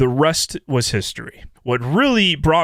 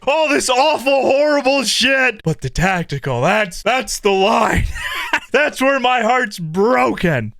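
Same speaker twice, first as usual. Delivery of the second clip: can't keep a straight face; comic heartbreak cracking into laughter; laughing mock grief; 7 semitones higher than usual. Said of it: "I can't even say that with a straight face".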